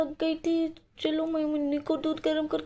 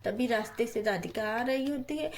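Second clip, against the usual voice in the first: low, soft storyteller voice; story narration; 6 semitones lower, 5 dB quieter than usual. A narrator's reading. Narrator telling a child's doll story, low and soft. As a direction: narrate the scene calmly and low.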